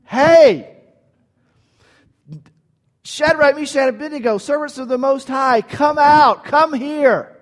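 loud, high shouted summons; king calling out loud and high; yelling king. Acted. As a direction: yell into the furnace opening. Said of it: "begins to yell at the opening".

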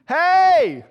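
high, drawn-out shout; loud, high-pitched bellowed command; yelling at the ants. Acted. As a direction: holler orders down at tiny creatures. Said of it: "start yelling at the ants".

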